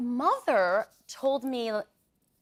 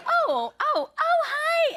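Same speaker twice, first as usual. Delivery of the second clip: very high startled oh; mock flustered stranger; caught-off-guard stranger; 3 dB louder, much slower than usual. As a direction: squeak it like you've been caught out. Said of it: "And they're like".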